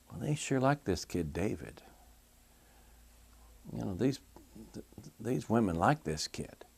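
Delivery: a quiet, suspicious king talking to himself; Saul muttering to himself. Acted. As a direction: mumble it under your breath, jealous. Saul voiced musing to himself.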